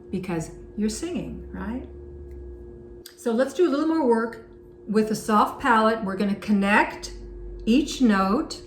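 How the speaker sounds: ordinary delivery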